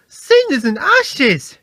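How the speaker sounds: high, loud, slow surprised cry; exclaiming. A narrator's reading. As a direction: burst out in astonishment. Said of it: "exclaimed Thomas".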